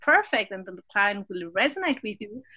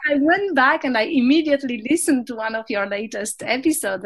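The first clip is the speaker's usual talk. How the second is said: ordinary delivery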